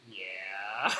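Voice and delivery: low, drawn-out rising yeah; imitated Claire reaction. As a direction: drag it upward from a low pitch. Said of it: "Kinda like".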